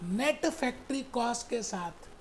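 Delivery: ordinary talk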